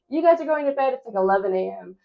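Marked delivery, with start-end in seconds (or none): 0.1-0.9 higher, louder mock-stern order; retelling a fed-up order to the kids; half-acted frustrated command to cranky boys; frustrated mom. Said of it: "I'm getting frustrated, and I'm like".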